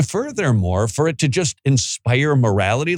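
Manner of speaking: normal talking voice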